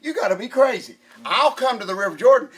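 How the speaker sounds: loud, high mock outrage; hammed-up wrestler outrage, loud and high; indignant shout. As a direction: blast it like a wrestler refusing orders. Voicing a wrestler's indignant refusal.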